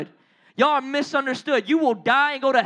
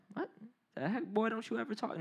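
quiet, half-voiced mock sass; mock attitude; 3 semitones lower, 13 dB quieter, faster than usual. Woman answering an order to go, with mock attitude. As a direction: toss back a muted, joking retort. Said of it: "hit me with some attitude".

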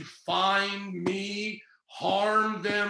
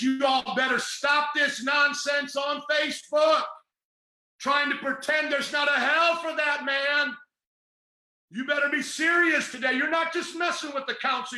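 preaching at full force; 5 semitones higher than usual. Real, not acted. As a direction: thunder it at the congregation like a Pentecostal preacher. Preacher shouting at Facebook crowd.

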